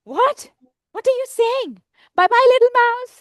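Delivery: high-pitched startled snake; storybook snake in a panic; alarmed snake. Acted. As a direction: jump up high, ending in a loud goodbye. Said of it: "The snake says".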